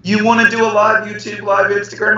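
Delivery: high, sweet imitation of a colleague asking a favour; playful mock impression of a coworker; mimicking Halima. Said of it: "And the next time Teacher Halima says".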